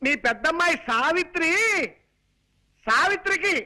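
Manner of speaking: high, emphatic announcing voice; strong voice. Acted. Son-in-law announces match to half-deaf mother-in-law, high strong voice. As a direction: pitch it up for deaf ears. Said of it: "స్ట్రాంగా?".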